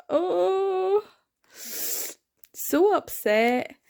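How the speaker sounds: whimpering slow "so upset" with a cry; drawn-out wail over the book; mock crying. Real, not acted. Reader moaning about the sad chapter, crying.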